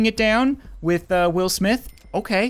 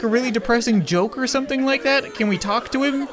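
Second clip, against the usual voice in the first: normal talking voice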